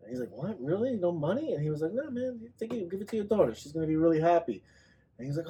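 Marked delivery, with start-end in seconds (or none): 0.3-1.5 high, rising astonished questions; imitated disbelief. Voicing man amazed at free socks. 1.9-4.6 higher-pitched, friendly urging; imitated generous insistence. Voicing the seller insisting on giving.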